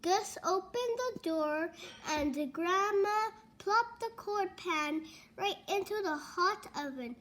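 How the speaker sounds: ordinary delivery, nothing marked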